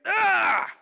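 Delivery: loud, high scream of protest; anguished outcry. Acted. Heavens and earth protest the crucifixion, screamed "Ah!". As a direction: cry out as all creation objecting. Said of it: "the heavens and the earth are all saying".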